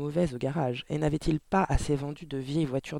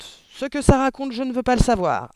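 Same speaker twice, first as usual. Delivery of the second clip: barked angrily; 10 semitones higher, 9 dB louder than usual. A narrator's reading. Father refusing to hear about the book, barking. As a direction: cut in, barking at the child. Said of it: "aboya monsieur Verdebois".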